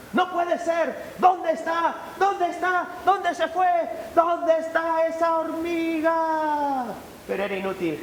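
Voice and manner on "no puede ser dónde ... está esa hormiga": higher, louder, frantic searching; where did it go, disbelieving; baffled hunter. Acted. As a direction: hunter can't find the ant, exasperated.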